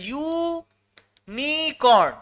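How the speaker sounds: ইউনিকর্ন stretched very slowly, syllable by syllable; slow teacher's sounding-out of ইউনিকর্ন; drawn-out spelling demo. Shown as a demonstration. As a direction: hold the ইউ long in ইউনিকর্ন, then set নি and কর্ন apart. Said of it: "ইউনিকর্নের তো বানান এইটা".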